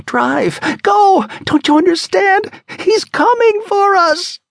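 high, loud frantic urging; panicked screaming. Acted. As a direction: scream at her to drive now. Terrified woman urging escape.

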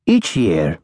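a lightly voiced old professor, soft and lilting; gentle sing-song lilt; soft, lilting old lecturer. A narrator's reading. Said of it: "he said in a soft, sing-song voice".

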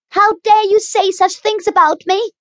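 put-on high, loud furious girl; vehement. A narrator's reading. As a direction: hurl it back at her. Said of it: "she repeated vehemently".

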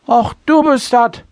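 put-on slurred, high and loud old drunk; drunken slurring. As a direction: slur it like a tipsy old sea captain. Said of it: "lallte der pensionierte Captain".

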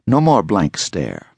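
ordinary delivery, nothing marked

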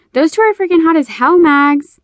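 high, loud gushing excitement; excited. A narrator's reading. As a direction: squeal it out, thrilled and eager. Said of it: "Callie's voice was full of excitement mixed with lust".

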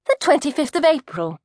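forced brightness to the nosy coat check lady; brightly. Acted. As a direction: put on a cheery front. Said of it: "said Maggie brightly".

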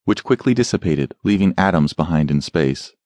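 ordinary delivery

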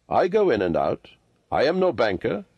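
ordinary delivery

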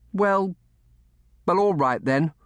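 put-on high, halting boy voice; grudging surrender. A narrator's reading. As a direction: give in reluctantly, word by word.

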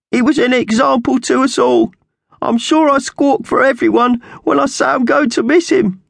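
loud, very high squawky eulogy; grieving magpie character. Acted. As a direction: squawk a tearful farewell at high pitch. Slasher's eulogy, breaking down.

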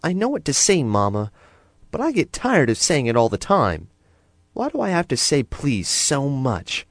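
put-on a small girl complaining to her mother, a touch sulky; cross little girl. A narrator's reading. Daughter grumbling to Mama about saying please, mildly cross. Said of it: "looked at her mother crossly".

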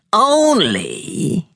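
high, scornful witch rising on Only. A narrator's reading. Witch mocking Grinny's excuse, scornful. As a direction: scoff the word like a crone. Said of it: "her voice would tear tin plate".

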